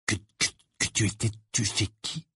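soft, halting near-whisper; murmuring. A narrator's reading. Boy secretly asking Harry about You-Know-Who, murmured stammer. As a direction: stammer it under your breath. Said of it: "murmura-t-il".

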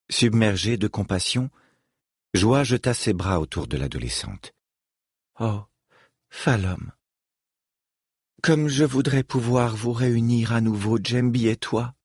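plain reading voice

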